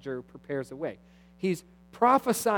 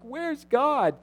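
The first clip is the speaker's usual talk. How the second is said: mild mock lament; 11 semitones higher, 5 dB louder than usual. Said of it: "you're like".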